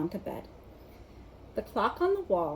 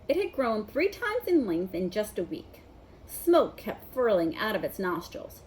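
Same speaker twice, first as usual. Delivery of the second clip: usual speaking voice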